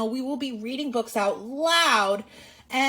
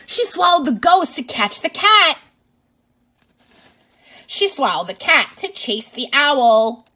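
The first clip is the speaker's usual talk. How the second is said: lively, louder read-aloud voice; reading to children; 3 semitones higher, 7 dB louder than usual. Acted. Teacher reading a picture book to kids, lively and loud. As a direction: read it out big for little listeners.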